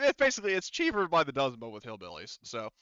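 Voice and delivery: ordinary talk, nothing marked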